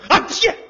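loud, high-pitched sneeze; mock sneeze. Father catches the child's cold at once, mock sneeze. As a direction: burst out with a fake sneeze. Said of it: "你这感冒也太快了".

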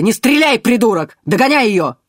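high, loud angry shout; shouted command. A narrator's reading. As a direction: yell at the guard. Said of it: "разборчивая команда".

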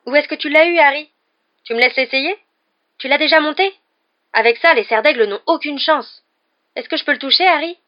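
high, loud, eager questions; excited crowd of wide-eyed fans; marvelling exclamations. A narrator's reading. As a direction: gush like an awestruck admirer. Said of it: "poussaient des exclamations émerveillées".

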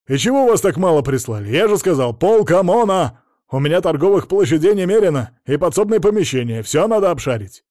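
blustering boss at high volume; irate demands. A narrator's reading. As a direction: berate them for sending too few. Angry owner demands more men.

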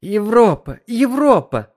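high, loud spluttering. A narrator's reading. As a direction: cough it out while spitting water. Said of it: "отплёвываясь".

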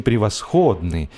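plain reading voice

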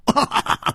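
high burst of laughter; short, bright-pitched guffaw; big laugh. A narrator's reading. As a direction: burst out laughing before speaking. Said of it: "蝴蝶花也大笑".

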